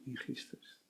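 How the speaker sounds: a hushed, secret aside; whisper. Acted. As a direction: breathe "In Christus" on a voiceless whisper, "In" slightly stressed. Quiet refrain after "Hoe vrij je?.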